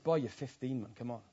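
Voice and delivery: low, soft mock dismissive; teacher teasing a heartbroken boy; retold teasing brush-off. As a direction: wave it off, a bit teasing. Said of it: "I said".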